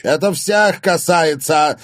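slow, high, loud announcement; sudden loud shout. A narrator's reading. Father addresses the whole party, shouting. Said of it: "вдруг громко крикнул он".

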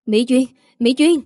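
lightly voiced anxious call. Husband calling his fainted wife's name, mild urgency. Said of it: "cô nghe tiếng gọi".

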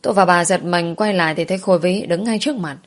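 ordinary delivery

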